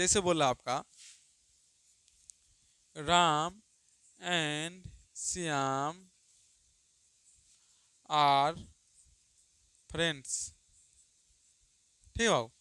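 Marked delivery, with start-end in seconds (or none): 3.0-10.3 word by word, with long gaps; slow dictation. Shown as a demonstration. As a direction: read it out so students can write. Dictates example sentence. (धीमे-धीमे बोलकर लिखवाना)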